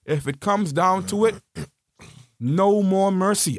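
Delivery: usual speaking voice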